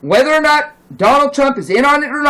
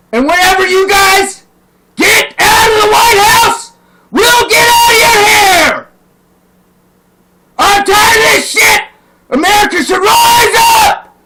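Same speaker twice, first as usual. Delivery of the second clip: high, loud, voice cracking with rage; real furious tearful yelling; furious crying shout; 9 semitones higher, 5 dB louder than usual. Real, not acted. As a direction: scream it at them, near tears. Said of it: "but I'm pissed off".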